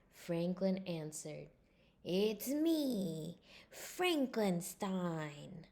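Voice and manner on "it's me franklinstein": slow, drawn-out spooky voice; spooky monster voice. Acted. Franklin scaring his father in costume, slow spooky voice. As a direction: stretch it out like a monster. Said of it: "In his deepest, spookiest voice".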